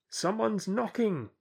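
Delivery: high, slow voice muffled behind a gate. Acted. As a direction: call through a thick wooden door. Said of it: "said the muffled voice".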